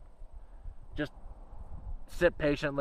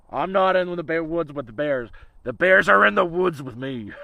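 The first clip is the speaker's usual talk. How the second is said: mock tough boast; 2 semitones higher, 6 dB louder than usual. Restates the line as a boast.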